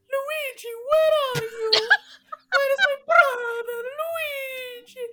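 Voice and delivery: high wailing toy voice calling for Luigi; crying Mario toy. Retold or imitated. Toy Mario crying out for his brother, high wailing. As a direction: wail for your lost brother like a toy. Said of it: "has him call out".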